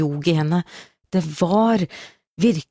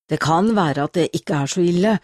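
ordinary delivery, nothing marked

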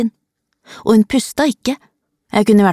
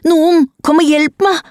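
high, strained cry; shrieking for help; 10 semitones higher, 3 dB louder than usual. A narrator's reading. Child beside a body begs for help, high shriek. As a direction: shriek for someone to come. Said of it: "skreik jeg og kjente ikke igjen stemmen min".

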